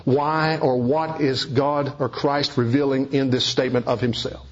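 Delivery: ordinary delivery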